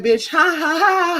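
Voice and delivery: high cackling taunt, voicing the possum; mocking possum laugh. Possum on the fence laughing at the vlogger, high mocking cackle.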